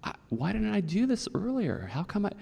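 high, marveling voice of a friend amazed at the ocean; excited wonder. Retold or imitated. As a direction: quote his amazement, pitch up. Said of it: "He goes".

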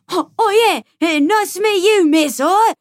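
loud, high mock Cockney accent; hammed-up British accent. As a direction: put on a terrible, over-loud English street accent. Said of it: "be stuck in that accent".

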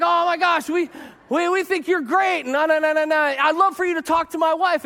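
a churchgoer thrilled to spot a pastor; gushing fan. Retold or imitated. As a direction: gush like a starstruck fan. Fan gushing over pastor friend.

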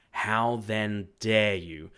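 loud, very slow, weighted words; indignant challenge. A narrator's reading. Master woodsman demanding the king obey, indignant. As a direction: challenge them, stretching each word.

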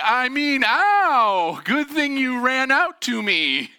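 high, loud, with a drawn-out "ow"; mock exclamation. Son seeing his dad's bleeding leg, hammed-up exclamation. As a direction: exclaim it big, stretching the words out.

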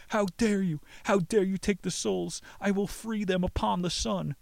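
high, indignant story-voice yell; angry yell. A narrator's reading. Yelled in anger during the cave confrontation, high indignant cry. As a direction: cry it high with outrage, each phrase falling. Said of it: "began to yell in anger".

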